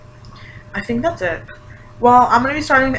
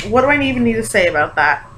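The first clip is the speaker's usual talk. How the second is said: usual speaking voice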